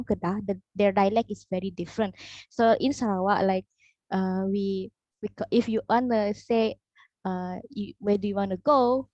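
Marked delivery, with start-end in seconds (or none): none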